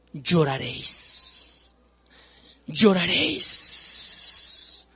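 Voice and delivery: lloraréis drawn out in a low breathy hush; slow ominous reading. Acted. Preacher reading the woe to the congregation, slow and dark.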